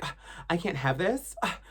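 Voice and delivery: high, loud whiny tantrum without yelling; dramatic teen whine. Retold or imitated. Spoiled teen whining at mom after a spat, high and loud. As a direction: wail like a spoiled teen denied something. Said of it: "No yelling".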